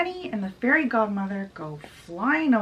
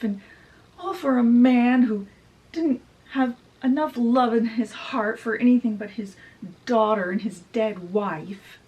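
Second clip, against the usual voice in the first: retold ranting; 4 semitones higher, 3 dB louder than usual. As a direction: rant and wail as an embittered mother, stretching the words.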